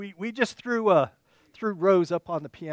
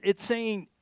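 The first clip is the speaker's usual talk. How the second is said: ordinary talk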